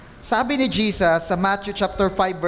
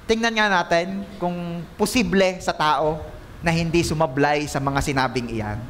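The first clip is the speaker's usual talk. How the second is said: ordinary talk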